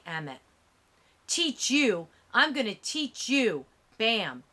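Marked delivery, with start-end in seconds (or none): 1.3-3.6 higher, louder furious taunt; angry threat. A narrator's reading. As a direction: snarl it while punching. Man beating Emmett in truck.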